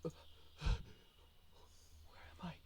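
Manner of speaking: breathless, hushed bewilderment; panicked and disoriented. Acted. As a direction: gasp it while getting your bearings. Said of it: "the sense of panic's still clear".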